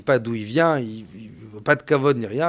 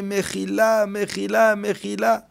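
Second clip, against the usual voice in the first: pleading for forgiveness; 7 semitones higher, slower than usual. Acted. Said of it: "il se met à pleurer".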